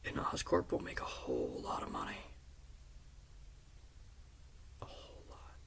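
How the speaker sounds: soft, sinister whisper; menacing Goblin whisper. Retold or imitated. Goblin tells Peter how Oscorp profits, in a sinister whisper. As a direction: let the threat trail off into a whisper. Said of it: "And he says".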